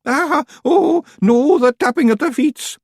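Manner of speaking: very high, loud keening wail; keen like mourners; mock lament. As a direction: Feagles crying out in mock despair. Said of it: "bang their heads on trees".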